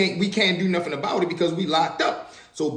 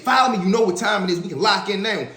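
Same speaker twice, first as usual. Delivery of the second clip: challenging inmate; 5 semitones higher than usual. Retold or imitated. Inmate daring a rival to follow and fight, menacing. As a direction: call someone out to fight.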